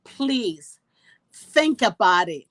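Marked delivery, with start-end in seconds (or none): none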